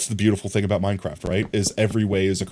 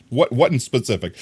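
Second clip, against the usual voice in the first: ordinary delivery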